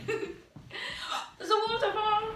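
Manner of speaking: high slow laughing shout; giggling excited warning; laughing call. Real, not acted. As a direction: laugh as the waterfall appears. Laughing during game.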